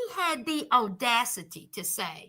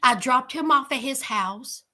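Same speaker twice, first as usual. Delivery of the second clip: normal talking voice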